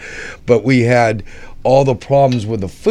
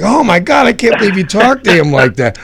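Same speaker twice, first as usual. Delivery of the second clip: high, fast mock-shocked gasp; hammed-up panic over a rude reply; upset Dominic; 9 semitones higher, 4 dB louder, faster than usual. Colleague mocking Dominic's shocked upset, high and fast. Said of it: "Dominic got all upset, like".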